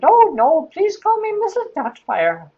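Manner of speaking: high falsetto English nanny; hammed-up high English lady; Missus Doubtfire. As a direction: gently correct in a put-on English matron voice. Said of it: "an English woman".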